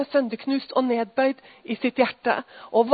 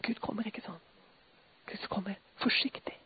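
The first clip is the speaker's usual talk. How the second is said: soft unvoiced whisper, much softer; 8 semitones lower, 19 dB quieter than usual. Real, not acted. Preacher telling how God comes, whispering. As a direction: hush it as if not to scare him. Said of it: "Nå begynner jeg å hviske".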